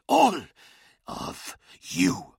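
forced out, ending in a breathy hiss; strained hiss. Acted. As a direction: spit out the words through the struggle. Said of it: "hissed Skarsen, fighting for every word".

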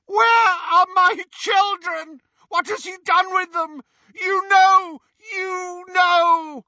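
high, cracked, distraught shouting. A narrator's reading. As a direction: cry out for lost children. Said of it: "His voice was cracked, high-pitched. He sounded distraught".

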